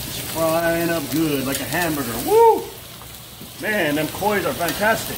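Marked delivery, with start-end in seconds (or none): 2.3-2.6 high, loud cheer; excited whoop. Real, not acted. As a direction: let out a thrilled whoop. Cheering at frying fish cakes.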